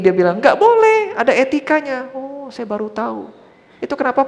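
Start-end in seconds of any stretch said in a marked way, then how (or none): 0.4-2.1 high, loud prohibition that eases off; emphatic, scolding correction; retold trainer's reprimand. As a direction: imitate an instructor correcting a trainee. Acts out trainer forbidding a posture.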